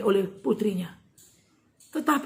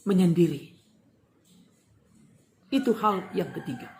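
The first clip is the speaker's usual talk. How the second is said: normal talking voice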